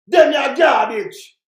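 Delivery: slow, loud shouted insult; angry shout. Real, not acted. Commentator shouting at the leaders he despises, slow and loud. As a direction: hammer each word out slowly and loudly.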